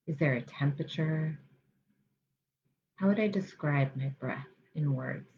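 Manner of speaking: soft, musing self-inquiry; gentle curiosity. Shown as a demonstration. Meditation guide models noticing the breath, gently curious. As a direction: wonder quietly to yourself, pausing between questions. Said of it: "Bringing an attitude of curiosity".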